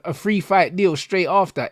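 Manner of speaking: normal talking voice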